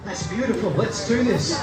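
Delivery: ordinary delivery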